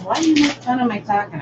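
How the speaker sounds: retold complaint. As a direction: quote him high, as if protesting. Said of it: "he goes".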